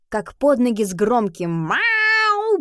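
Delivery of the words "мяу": high meowing cat. Acted. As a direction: meow like a cat. Cat meows in story.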